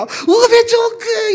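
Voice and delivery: 오베 좋은 그 very slow and high, crying out; Jeolla exclamation. Retold or imitated. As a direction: stretch 오베 좋은 그 with heartfelt delight. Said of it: "딸이 오니까".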